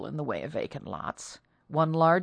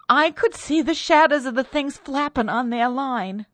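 high, loud, distressed account; horrified maid; 8 semitones higher, 6 dB louder than usual. A narrator's reading. Terrified maid describes a shadow, high and horrified. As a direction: tell it fearfully, pitched up throughout.

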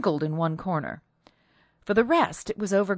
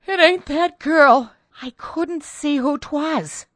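high, slow, distressed maid's voice; terrified protest; 10 semitones higher, 8 dB louder, slower than usual. A narrator's reading. Terrified maid protests to her mistress, high and slow. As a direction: drag it out high, loud at first.

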